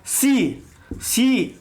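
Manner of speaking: "सी" said with the tongue turned back, shown twice; tongue-turned see. Shown as a demonstration. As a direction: curl the tongue and say "सी". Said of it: "जीभ को हम लोग मोड़ते हैं". (जीभ मोड़कर "सी" बोलिए)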